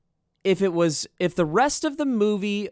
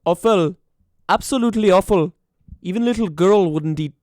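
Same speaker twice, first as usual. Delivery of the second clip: put-on a light, loud try at an Armenian accent; hint of Armenian accent reading a cereal review; 5 dB louder than usual. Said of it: "this is an Armenian person that, uh, read this".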